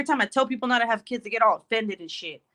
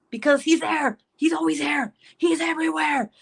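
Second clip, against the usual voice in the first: higher, escalating comic exasperation; exasperated venting; 7 semitones higher than usual. Real, not acted. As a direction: wail it as if there is no escape. Venting about clinging son, escalating.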